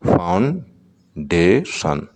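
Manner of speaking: Foundation stretched slowly, syllable by syllable; slow syllable demo. Shown as a demonstration. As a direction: spell out foundation by its beats. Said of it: "foundation में कितने". ("Foundation" बहुत धीरे, तीन हिस्सों में)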